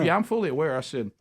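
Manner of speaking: usual speaking voice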